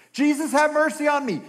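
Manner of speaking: high, loud plea; calling out. Acted. Blind man calling to Jesus, loud pleading. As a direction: beg loudly over others' talk.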